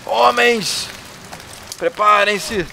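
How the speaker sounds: high, loud call to arms; rally the men like a war chief; rallying shout. Acted. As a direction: chieftain rousing his men for battle, shouting. Voicing a leader rallying men.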